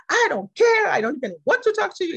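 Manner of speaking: mock the fuming man's high, loud refusal; retold angry outburst. The angry man brushing her off, imitated high and loud. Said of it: "He was really mad".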